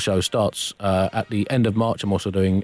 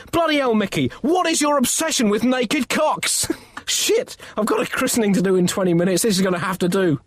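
exasperated; 9 semitones higher than usual. Acted. Exasperated Pope.

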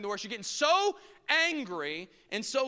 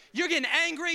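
ordinary talk

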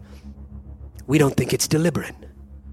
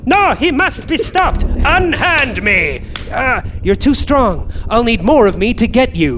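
high frantic self; 7 semitones higher, 4 dB louder than usual. Acted. Selves wrestle each other.

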